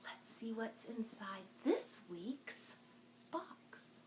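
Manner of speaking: hushed, secret voice to build suspense; suspense whisper. Real, not acted. Librarian to kids opening mystery box, hushed whisper.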